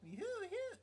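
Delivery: high swooping whoops; playful siren-like calls; mock cackle whoops. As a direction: sing out silly high-pitched whoops. Imitates the killer's shrill laugh.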